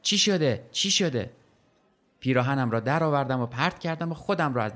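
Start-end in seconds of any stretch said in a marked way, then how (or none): 0.0-1.3 high, alarmed repeated cries; panicked shouting. A narrator's reading. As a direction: voice a terrified boy calling out.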